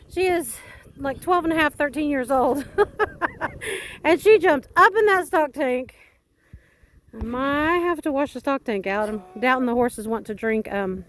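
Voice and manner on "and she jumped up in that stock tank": laughing. Real, not acted. Laughing about the dog.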